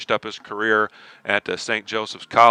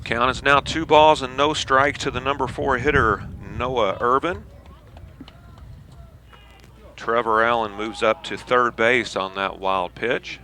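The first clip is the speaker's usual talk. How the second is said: play-by-play; 4 semitones higher, 3 dB quieter than usual. Acted. Live baseball play-by-play.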